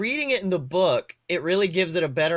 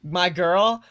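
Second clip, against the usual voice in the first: ordinary talk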